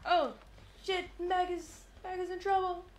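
high, slow alarmed cry; sudden worry for a teammate. Real, not acted. Teammate in danger.

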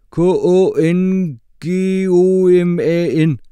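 "K O N G O M A N" spelled slowly, letter by letter, loud. A narrator's reading. Mohammed spells "K O N G O M A N" back at the teacher. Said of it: "stavede Mohammed".

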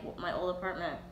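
normal talking voice

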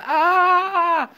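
long, high-pitched held "aah"; acted tantrum yell. Teacher shows the class how he used to scream, mock tantrum yell. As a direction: yell like a spoiled child, high and sustained. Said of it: "Yo pateaba a mis hermanos".

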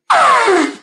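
high, loud lip-blown trumpeting; elephant trumpet. Shown as a demonstration. Librarian showing children her special skill, loud elephant trumpet. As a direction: lips together, blow out an elephant blare. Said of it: "do a pretty good elephant noise".

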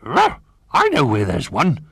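a keen dog yapping the news; barking dog. A narrator's reading. Ruff offers to fetch a ball, barking eagerly. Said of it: "barked Ruff".

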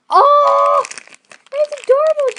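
high, loud squeal; excited squeal. Real, not acted. Unboxing for viewers, a high loud squeal. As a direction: shriek with delight. New toy revealed.

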